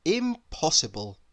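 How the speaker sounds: plain reading voice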